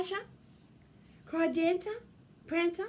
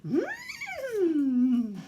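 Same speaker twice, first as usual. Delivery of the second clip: high, loud horsey whinny; whinny like a prancing horse; 7 semitones higher, 7 dB louder than usual. Shown as a demonstration. Showing children how you prance, loud high whinny. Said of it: "That's how you prance".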